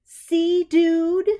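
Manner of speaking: high, loud mock scolding; teasing self-talk. A narrator's reading. Clarissa teasing herself after the lane slip, high mock scold. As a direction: needle yourself like a buddy.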